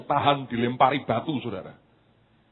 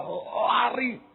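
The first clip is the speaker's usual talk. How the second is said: sudden dash; 9 semitones higher than usual. Retold or imitated. Acted sudden dash in story.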